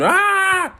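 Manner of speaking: high mock scream of shock; imitated scream. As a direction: scream back in surprise, re-enacted. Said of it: "I've screamed".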